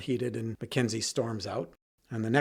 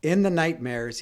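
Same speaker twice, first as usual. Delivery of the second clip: usual speaking voice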